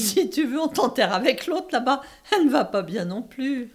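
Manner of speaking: mocking. Mocking suggestion, laughing tone.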